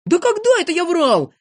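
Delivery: high-pitched disbelief; shocked high outcry; indignant surprise. A narrator's reading. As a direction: protest the accusation in amazement. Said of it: "удивился Незнайка".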